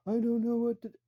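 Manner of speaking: high, loud imitation of a wailing kid; mock wailing. Boy with dog poop on his shoe, mock wailing. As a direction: wail it out, mimicking a child in meltdown. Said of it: "He starts screaming and wailing even louder".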